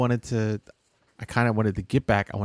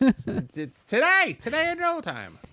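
pushy announcer; 13 semitones higher than usual. Acted. Mock announcer demanding guests.

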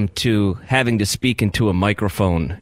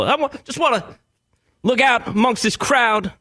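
preachy rock star; 9 semitones higher than usual. Retold or imitated. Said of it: "everybody turns into a preacher".

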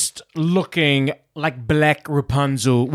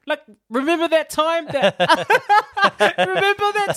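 very high, laughing mock small talk; squeaky-high fake reminiscing through laughs; awkward forced reminiscing; 13 semitones higher than usual. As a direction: play awkward old pals, breaking into laughter. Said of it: "that awkward convo that you have".

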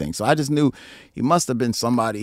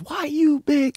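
high mock voice; slower than usual. Imitating bassist's catchphrase.

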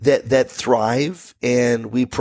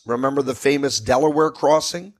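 ordinary delivery

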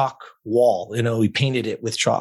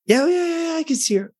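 flustered client. Retold or imitated. Client insisting she saw herself.

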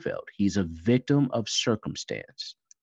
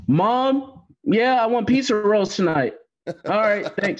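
loud call to another room, higher; yelling across the room; 10 semitones higher, 6 dB louder than usual. Pretend. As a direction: shout to mom down the hall. Calls to mom in another room.

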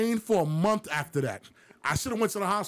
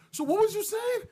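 much higher, looking up at a big man; small guy looking up; 12 semitones higher than usual. Retold or imitated. Teller imitates himself squaring up to a giant, higher. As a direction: pipe up at him from below. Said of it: "I'm looking up".

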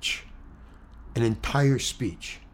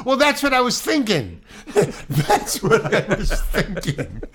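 high, laughing agreement; amused laughing reply; 8 semitones higher, 3 dB louder than usual. Real, not acted. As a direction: laugh it off, pitched up. Laughing at interviewer's joke.

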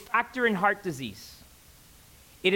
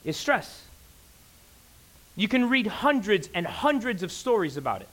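ordinary talk, nothing marked

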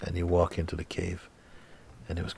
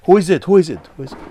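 much higher, much louder frightened calls; startled calling out; 12 semitones higher, 14 dB louder, much faster than usual. Acted. The seeker calls into the dark cave, frightened. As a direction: call into the dark, scared.

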